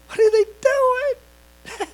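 very high, slow mocking squeal. Jericho onlookers ridicule the marchers, high and mocking. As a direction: sneer with a drawn-out high wail.

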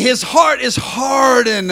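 loud, slow crying preacher shout; preaching cry. Acted. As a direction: wail that his heart is hardened.

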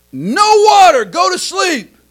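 high, loud stern mother; stern mom. Retold or imitated. Mother snapping back down the hall at her toddler. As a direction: cut him off sharply.

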